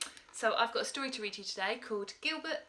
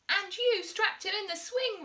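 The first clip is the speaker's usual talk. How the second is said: crying out; 11 semitones higher, 4 dB louder than usual. A narrator's reading. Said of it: "Rita cried".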